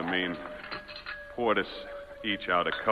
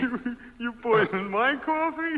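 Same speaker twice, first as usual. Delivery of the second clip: mock shock; 14 semitones higher, slower than usual. Victim realises the poison twist.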